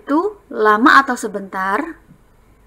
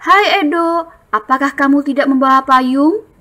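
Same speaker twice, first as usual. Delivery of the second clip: higher, lively storybook voice as Benny; reading dialogue; 5 semitones higher, 5 dB louder than usual. Shown as a demonstration. Teacher reading Benny's line to class. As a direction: greet a friend brightly. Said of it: "Bacanya dengan intonasi yang tepat".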